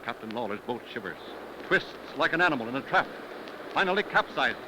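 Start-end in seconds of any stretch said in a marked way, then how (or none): none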